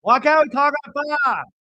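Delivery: high, loud imitated outburst; host keeps mimicking Lisa, high and loud; exploding shout. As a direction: keep yelling it at the table. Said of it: "how she explodes".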